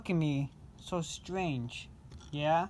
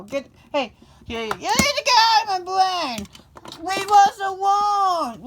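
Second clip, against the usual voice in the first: much higher, louder furious shouting; road rage yelling; 10 semitones higher, 9 dB louder than usual. Pretend. Pretend driver yelling at the car that cut in, furious. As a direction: scream at the driver who cut you off.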